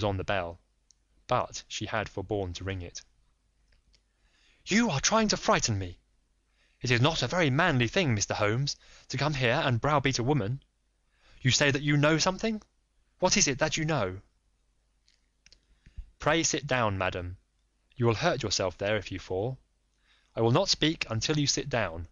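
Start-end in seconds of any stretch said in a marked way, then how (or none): 4.7-14.2 higher, anxious noblewoman voice; lady pleading secrecy; Lady Hilda. Acted. Frightened wife to Holmes, higher voice. 16.2-22.1 lightly voiced firm detective; polite but firm, a touch raised; Holmes. Holmes pressing the lady, lightly voiced firm.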